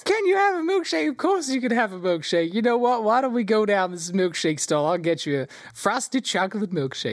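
high-pitched indulgent gnome voice; delighted gnome showman. Acted. Gnome offering the excited child a milkshake, gushing. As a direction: gush theatrically to a small child.

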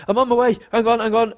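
high-pitched, breathless mock hurry; high excited mock hurry. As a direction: pretend to rush, voice up high. Best man pretending to run.

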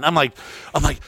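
ordinary delivery

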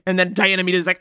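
ordinary talk, nothing marked

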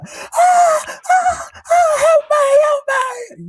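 very high, loud mock shrieks for help; hammed-up high-pitched screaming; imitated screaming. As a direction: imitate a panicked screamer begging for rescue. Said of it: "Tom's screaming like".

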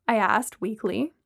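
ordinary delivery

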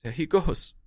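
choked-up farewell to a dog; close to tears. Acted. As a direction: hold back tears. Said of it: "are you crying, sir?".

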